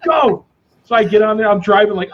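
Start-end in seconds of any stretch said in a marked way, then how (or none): 0.0-0.4 high, barked command; stern dad order. Retold or imitated. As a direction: bellow it like a strict ex-marine father. Dad voiced in story.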